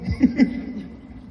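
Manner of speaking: real high-pitched laugh. Real, not acted. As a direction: burst into a happy laugh. Laugh after story.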